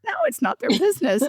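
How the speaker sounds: high, laughing "No, it's not their business."; laughing retort. Retold or imitated. Coach asks about introversion, she answers laughing. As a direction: laugh through the repeated answer. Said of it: "Do they know you're an introvert?".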